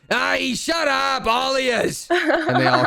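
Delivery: high, drawn-out yell at a crowd; long high-pitched doorman holler; dwarf yelling. Acted. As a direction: silence the rowdy queue. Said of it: "he yells".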